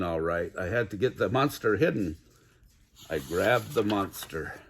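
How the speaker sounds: plain reading voice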